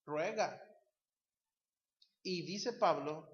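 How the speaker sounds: ordinary talk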